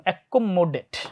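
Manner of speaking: অ্যাকোমোডেট slow, with kk and mm lengthened; doubled consonants. Shown as a demonstration. Teacher demonstrating her spelling trick, slow and doubled. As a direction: stretch the doubled letters in অ্যাকোমোডেট. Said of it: "আবার এইখানেও double উচ্চারণ করতাম".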